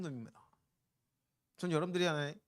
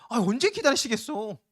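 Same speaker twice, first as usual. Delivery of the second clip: usual speaking voice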